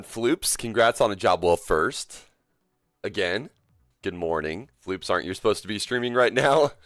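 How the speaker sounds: usual speaking voice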